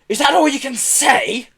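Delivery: high, loud indignant bellow. A narrator's reading. Pelly unsatisfied with Herb's reply, bellowing. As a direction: bellow in exasperation. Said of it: "bellowed Pelly".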